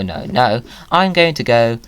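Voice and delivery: ordinary talk, nothing marked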